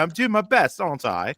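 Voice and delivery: high mock British accent; cheeky put-on British lad. Shown as a demonstration. Showing off a British accent for the co-host. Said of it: "did you wanna give a quick demonstration".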